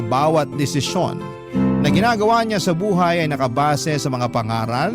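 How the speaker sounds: usual speaking voice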